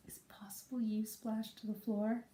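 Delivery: whispered wry question; dry whisper. Retold or imitated. Mother whispering her doubt to her daughter. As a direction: whisper it with gentle sarcasm. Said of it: "I'm like".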